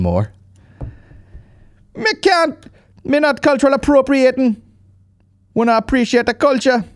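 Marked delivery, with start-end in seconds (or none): 2.0-6.8 high, squeaky mock Jamaican patois; mock Jamaican accent. Host parroting the guest's Jamaican accent to mock him. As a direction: send up his patois, higher and louder. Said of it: "he can do the accent fucking down to a T".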